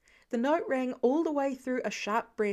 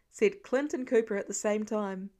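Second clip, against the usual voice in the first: usual speaking voice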